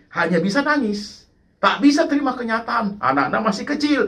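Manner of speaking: ordinary talk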